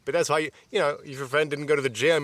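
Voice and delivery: normal talking voice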